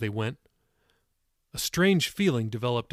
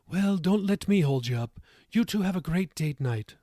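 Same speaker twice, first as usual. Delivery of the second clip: higher-pitched Irish grandmother; moderate Irish accent; 6 semitones higher than usual. A narrator's reading. Tilda sends the couple on date night, moderate Irish accent. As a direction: send the couple off with an Irish lilt. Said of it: "in her moderate Irish accent".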